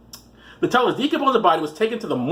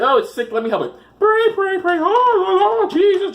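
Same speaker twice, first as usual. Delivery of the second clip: high, loud mock praying; frantic faith healer; 4 dB louder than usual. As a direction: wail out a hammed-up prayer over a sick child.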